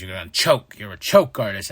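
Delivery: lightly voiced smug rival; low smug taunt. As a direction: needle him. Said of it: "he goes".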